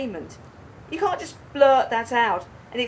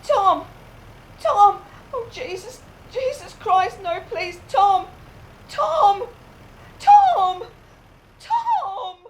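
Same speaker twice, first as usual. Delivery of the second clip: high, loud screams of his name, breaking into a cry; anguished screaming; 10 semitones higher, 5 dB louder, slower than usual. Acted. Wife screaming for her husband after the crash, anguished. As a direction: scream for him in horror.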